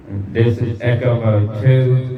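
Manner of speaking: longer delayed echo on the voice; slow words through a long mic echo; long echo trailing each word; echo mode two. Shown as a demonstration. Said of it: "this is like a longer delay in the echo".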